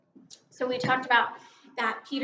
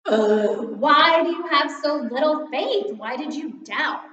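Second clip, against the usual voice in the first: louder and higher, fed-up disappointment; mad and disappointed; 4 semitones higher, 11 dB louder than usual. Retold or imitated. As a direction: scold him like a let-down teacher. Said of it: "pictured Jesus kind of being mad and disappointed".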